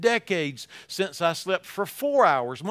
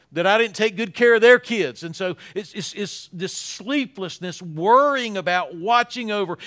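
normal talking voice

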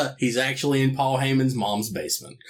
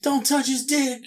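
mock boss shout; 9 semitones higher than usual. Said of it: "Jim Herd ran out and was like".

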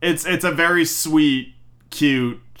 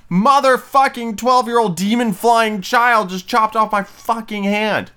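high, loud furious outburst; furious Hook; 9 semitones higher, 4 dB louder than usual. Retold or imitated. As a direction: explode like a maimed pirate. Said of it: "He went".

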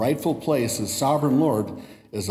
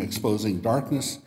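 usual speaking voice

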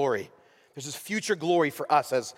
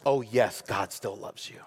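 reassuring; 7 semitones lower, 4 dB quieter than usual. Pretend. Paul's reassuring answer.

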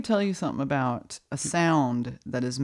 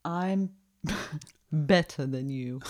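slow mock-posh British "better than you" with a laugh; posh British boast; slower than usual. Shown as a demonstration. Teasing the listener about British English, British accent. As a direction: put on a snooty British accent. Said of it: "take the word better".

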